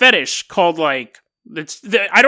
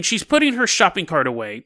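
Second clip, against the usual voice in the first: usual speaking voice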